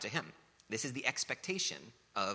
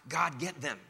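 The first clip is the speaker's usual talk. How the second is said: loud, higher demanding plea; mock vengeful prayer; 5 semitones higher, 5 dB louder, slower than usual. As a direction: cry it out like a grudge. Mock wrong prayer, acted out.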